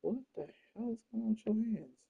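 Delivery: high, incredulous what the hell; mock shocked. Painting a figure, asking about its two-tone hands in high mock disbelief. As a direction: pitch it up in fake disbelief.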